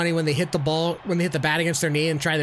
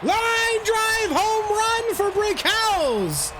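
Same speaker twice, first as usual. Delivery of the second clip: home run call; much slower than usual. Acted. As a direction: roar it out like a stadium announcer.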